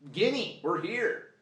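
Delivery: high, drawn-out mock plea; slow squeaky mock voice; voicing the fly. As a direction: stretch it out like a grabby little creature. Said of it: "it's like".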